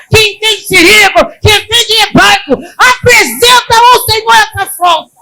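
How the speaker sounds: loud, high, driving sermon cry; preaching at full force. Acted. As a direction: hammer the congregation with it. Pregando com força. (pregação em voz alta e intensa)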